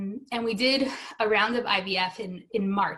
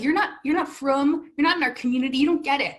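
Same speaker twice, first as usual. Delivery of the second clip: retold protest, a touch raised; higher, pressing reproach; indignant rebuke; 5 semitones higher, 4 dB louder than usual. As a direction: insist they cannot understand you. Acted rebuke to the dismissive doctor.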